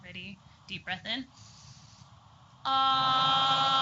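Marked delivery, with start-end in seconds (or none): 2.6-3.8 much louder, held high ah; loud long ah. Shown as a demonstration. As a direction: sustain it with full volume and breath. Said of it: "hold it out nice and loud".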